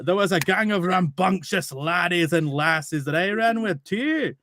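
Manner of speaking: high, rambling Scottish storyteller; Scottish accent. Retold or imitated. Reading the caravan man's speech aloud for the GM, Scottish accent. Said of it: "read in the actual accent".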